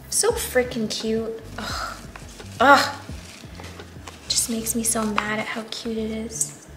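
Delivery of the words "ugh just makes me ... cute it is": ordinary delivery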